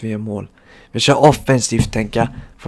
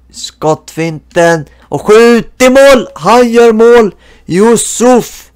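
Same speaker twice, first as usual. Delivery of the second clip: higher, louder, slow hammed-up goal call; mock sports commentator; 10 semitones higher, 8 dB louder, much slower than usual.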